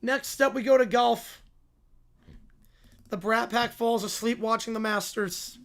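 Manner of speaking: ordinary talk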